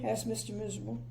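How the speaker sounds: plain reading voice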